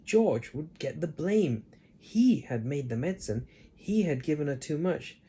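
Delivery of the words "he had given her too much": ordinary delivery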